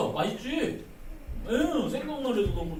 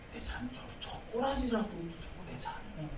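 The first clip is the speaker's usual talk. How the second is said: tender God voice; 3 semitones lower, 10 dB quieter than usual. Pretend. God's voice, tender.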